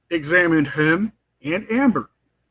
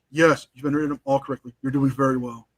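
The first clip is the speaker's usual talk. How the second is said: softer, faster mock condescension; mock praise; 7 semitones lower, 6 dB quieter, faster than usual. Depp trolling the lawyer, mock praise. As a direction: pat him on the head, trolling. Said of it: "Just trolling the guy".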